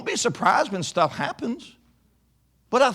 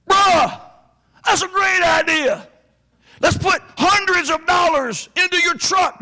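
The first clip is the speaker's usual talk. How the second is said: much higher, louder shouted over-the-top enthusiasm; mock hyped parent; 9 semitones higher, 6 dB louder than usual. Parent mocking the teen's truck plans with loud, high fake hype. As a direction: ham up a parent cheering on bad ideas.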